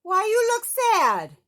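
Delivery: high, loud, goofy monster question; caring monster call to a sad boy; Cookie Monster voice. Acted. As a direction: shout it like a friendly furry monster. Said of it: "yelled Cookie".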